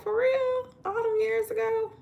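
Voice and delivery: high, incredulous friend's voice; surprised friend. Retold or imitated. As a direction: jump way up in pitch in disbelief. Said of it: "She was like".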